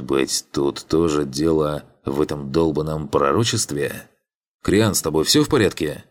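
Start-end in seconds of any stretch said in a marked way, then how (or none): none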